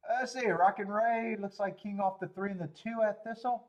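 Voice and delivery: high, loud, eager calling; excited race calling. Real, not acted. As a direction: call the race fired up.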